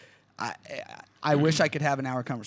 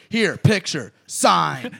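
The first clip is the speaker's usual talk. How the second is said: blunt, louder mock fan shoving a photo; curt demand; 4 semitones higher, 8 dB louder, much slower than usual. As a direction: bark it like an impatient fan. Fans demanding autographs, acted.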